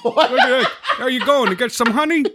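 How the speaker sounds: high, giggling neighbour calling out; a high-pitched, laughing neighbour yelling a nickname; laughing neighbour taunt. Acted. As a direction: tease him through laughter in a high voice.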